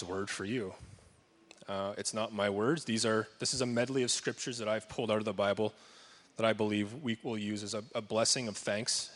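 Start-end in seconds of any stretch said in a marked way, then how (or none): none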